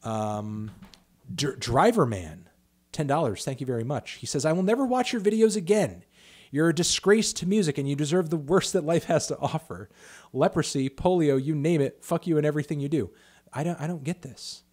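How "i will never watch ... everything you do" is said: loud, raised-pitch tirade; mock angry rant. Streamer reading a viewer's hate message, mock outraged. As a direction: declaim the hate message at volume.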